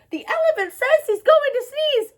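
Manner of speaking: high, shrill-pitched alarm call; shrieking warning. A narrator's reading. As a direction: shriek the news to everyone. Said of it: "With a shriek".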